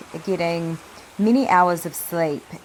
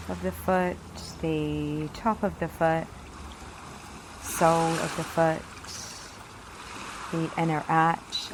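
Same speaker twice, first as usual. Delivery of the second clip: soft, unhurried meditation voice with long pauses; guided meditation; 7 dB quieter than usual. Acted. Leads a guided yoga meditation.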